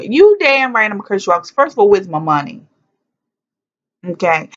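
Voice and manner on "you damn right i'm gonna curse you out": defiant. Retold or imitated. Retorting to her godmother.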